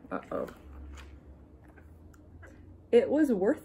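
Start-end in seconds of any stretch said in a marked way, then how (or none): 0.1-0.5 deep, soft uh-oh; low uh-oh. A narrator's reading. Reading to children, low worried uh-oh. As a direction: drop down into a worried uh-oh. Swimsuit mishap aside.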